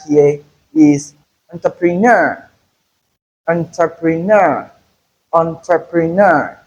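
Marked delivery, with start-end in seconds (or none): 1.5-6.6 entrepreneur as on-tre-pre-NUR; last-syllable stress. Shown as a demonstration. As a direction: lean on the end of entrepreneur. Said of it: "The stress is on the last syllable".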